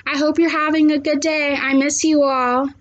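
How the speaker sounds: plain reading voice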